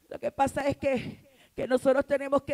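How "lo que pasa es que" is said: usual speaking voice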